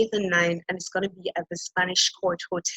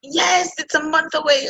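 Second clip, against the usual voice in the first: excited; 9 semitones higher than usual. Real, not acted. Nervous excitement about summit.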